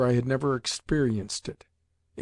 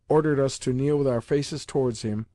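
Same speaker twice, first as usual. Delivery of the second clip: plain reading voice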